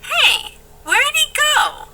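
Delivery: ordinary talk, nothing marked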